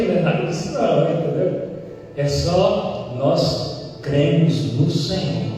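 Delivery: usual speaking voice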